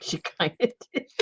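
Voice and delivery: fast echo with a laugh in the middle; giggling repeat of her words. Real, not acted. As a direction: tease her by echoing with a laugh. Teasing echo of friend.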